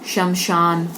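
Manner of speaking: शमशान said as shamshan, both श clear; correct sh sound. Shown as a demonstration.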